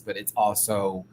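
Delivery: ordinary delivery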